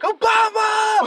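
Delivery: high, loud, slow drawn-out shout; hammed-up crazed shout; imitated yell. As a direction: bellow the name like a raving inmate. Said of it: "he would yell out crazy shit like".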